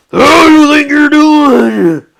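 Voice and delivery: slow, high furious yell; angry thug shout. Acted. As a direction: bark it down at the kid. Thug confronting narrator.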